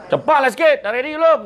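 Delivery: high, loud mock scolding; mock shrill yell. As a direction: bark at the husband in front of everyone, hammed up. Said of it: "cepatlah dia jerit dalam kedai".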